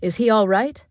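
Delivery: a touch of insistence; demanding. A narrator's reading. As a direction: press for an answer with rising pitch. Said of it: "the king demanded".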